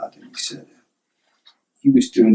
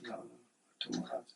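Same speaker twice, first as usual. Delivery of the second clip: hushed hurt reply; 24 dB quieter, faster than usual. Retold or imitated. Son's hurt reply retold.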